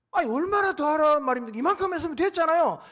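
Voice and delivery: loud, high-pitched angry protest; angry outburst. Acted. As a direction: flare up and shout back in protest. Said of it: "화를 내고".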